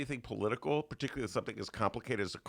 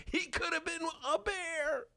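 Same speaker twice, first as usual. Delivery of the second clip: mock crying. Lamenting wistful quote.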